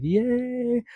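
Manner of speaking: very high, sung-out cheer; excited cheer. Retold or imitated. Hearing the case dismissed, a very high gleeful cheer. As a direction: squeal it with glee.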